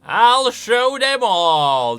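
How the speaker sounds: loud, drawn-out, high quote of a shout; mimicked battle cry. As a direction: belt it out slowly, imitating the character. Repeats the game's shouted line.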